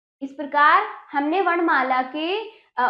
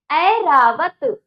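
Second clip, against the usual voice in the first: Airavat spelled out slowly and loud; slow loud model word; 2 semitones higher, 6 dB louder, much slower than usual. Shown as a demonstration. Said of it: "जोर जोर से उच्चारण करना पड़ेगा". (धीरे और ज़ोर से ऐरावत)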